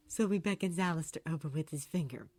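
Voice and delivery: ordinary delivery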